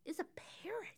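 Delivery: soft unvoiced reveal; whisper. Vlogger reveals the band's singer, in a whisper. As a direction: whisper it like a secret. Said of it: "dramatic reveal whisper".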